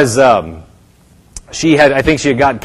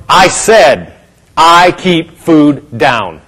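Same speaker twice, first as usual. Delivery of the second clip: emphatic declaration; 2 semitones higher, much slower than usual. Retold or imitated. Answering when it looks like it fails, bold slow insistence. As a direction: insist on it firmly, stretching each word. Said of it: "you say".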